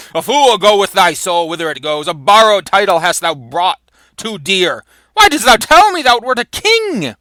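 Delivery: loud stage declamation, climbing high at the end; theatrical reproach; acted indignant rebuke. Douglas rebukes the dead impostor.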